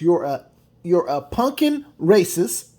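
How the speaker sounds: self-censored insult; softened swear. Real, not acted. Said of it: "Excuse my language".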